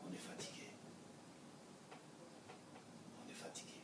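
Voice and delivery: weary, hushed, almost whispered; mock-exhausted whisper; tired mumble. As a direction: sigh it out like you're exhausted. Tired family brushing off Shabbat.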